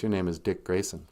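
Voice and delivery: usual speaking voice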